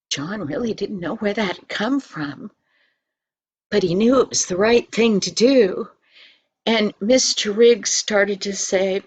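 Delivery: ordinary delivery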